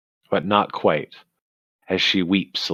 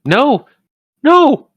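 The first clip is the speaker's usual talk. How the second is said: very high, louder, frantic squeaking voice; squeaky ermine; 7 dB louder than usual. Acted. Ermine squeaking back at the party, very high frantic squeak. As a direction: squeal it like a tiny panicked ermine. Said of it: "And they squeak back".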